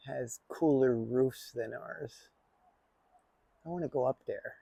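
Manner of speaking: ordinary talk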